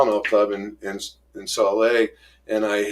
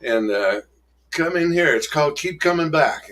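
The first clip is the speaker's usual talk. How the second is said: mock friendly old-timer; 8 semitones higher than usual. Old-timer invites newcomer to another meeting, lightly voiced and higher. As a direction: voice pitched higher, easy and welcoming. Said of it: "And he goes".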